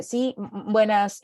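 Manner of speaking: mock stuttered greeting; nervous stammer. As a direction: trip over the first word nervously. Stammering in front of the public. (tartamudeo nervioso de broma)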